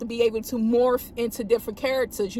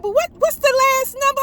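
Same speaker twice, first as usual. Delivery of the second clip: high, loud, fast exasperation; mock frustration at the radio; exasperated question; 12 semitones higher, 5 dB louder, faster than usual. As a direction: demand it, voice way up. Frustrated at cut-off call.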